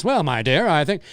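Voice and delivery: put-on a light mid-Atlantic accent, old film star. Shown as a demonstration. Showing the old fake Hollywood mid-Atlantic accent. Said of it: "talk like this".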